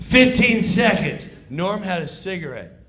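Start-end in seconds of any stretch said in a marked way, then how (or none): 0.1-1.2 loud countdown shout; countdown call. Retold or imitated. Stage manager counting down, loud call. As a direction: bark the time left.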